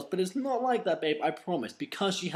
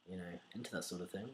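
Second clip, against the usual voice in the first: usual speaking voice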